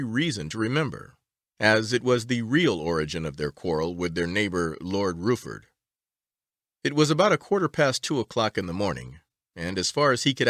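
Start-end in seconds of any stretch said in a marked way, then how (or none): none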